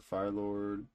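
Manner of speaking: ordinary delivery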